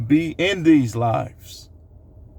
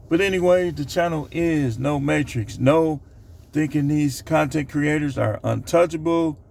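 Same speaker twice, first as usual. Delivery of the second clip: normal talking voice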